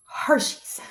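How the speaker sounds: a soft whispered punchline. Acted. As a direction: breathe the word like a secret.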